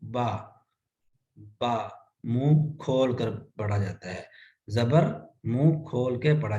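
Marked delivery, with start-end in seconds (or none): 0.0-2.0 बा with the mouth wide open. Shown as a demonstration. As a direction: say बा with an open, round a. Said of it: "जबर का मखरज भी ये है".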